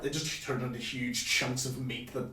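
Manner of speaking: usual speaking voice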